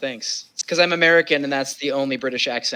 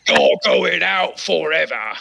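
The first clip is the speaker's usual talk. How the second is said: outraged Victorian master; 3 semitones higher, slower than usual. Pretend. Oliver Twist scene continued.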